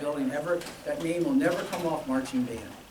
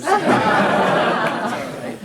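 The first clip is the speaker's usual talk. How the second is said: laughing; 7 semitones higher, 14 dB louder, much slower than usual. Real, not acted. Alumnus missed it, crowd laughs.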